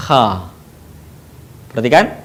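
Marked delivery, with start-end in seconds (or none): 0.1-0.5 "Kha" with a long low rasp at the back of the mouth; snoring KH. Shown as a demonstration. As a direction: rasp the start of "Kha" like a snore. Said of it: "seperti orang yang sedang mendengkur". ("Kha" seperti suara mendengkur)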